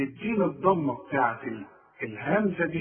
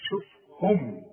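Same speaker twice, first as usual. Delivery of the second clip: normal talking voice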